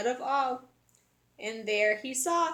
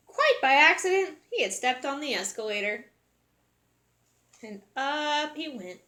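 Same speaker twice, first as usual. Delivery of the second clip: ordinary delivery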